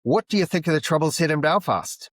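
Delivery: high, pushy mock Irish accent; bad Irish accent. Irish pub-goer questions a stranger about the Troubles, bad Irish accent. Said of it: "it's a very bad Irish accent".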